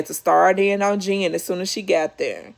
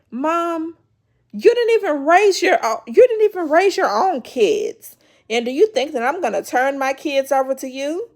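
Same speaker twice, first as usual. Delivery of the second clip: loud, higher mock holler; hollering at mom; 5 semitones higher, 3 dB louder than usual. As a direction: yell it at her mother, fed up. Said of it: "is gonna be hollering at Jackie".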